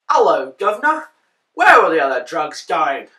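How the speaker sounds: put-on British greeting and question; British accent. Trying a voice for Batman, put-on British accent. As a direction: play it broadly British. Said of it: "I wouldn't go British".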